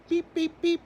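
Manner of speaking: very high tiny peeps; chick beeping. Acted. Bus driver finds a lost chick, tiny high peeps. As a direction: squeak like a baby chick.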